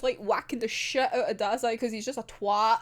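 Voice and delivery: normal talking voice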